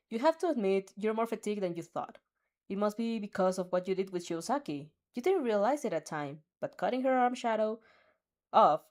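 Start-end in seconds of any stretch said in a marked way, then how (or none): none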